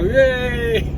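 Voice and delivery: high whooping cheer; high crowd whoop. Acted. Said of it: "They go".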